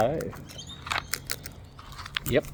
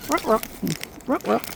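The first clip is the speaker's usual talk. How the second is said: high clucking ruck ruck; high bird call imitation; 9 semitones higher than usual. Greeting arriving birds with a high clucking call.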